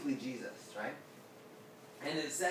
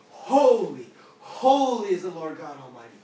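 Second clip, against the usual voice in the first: very loud, raised-pitch proclamation; angels crying out; 4 semitones higher, 13 dB louder than usual. Acted. Angels calling back and forth in the throne room, loud cry. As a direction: bellow it as if doorposts rattle. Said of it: "they're saying it so loud".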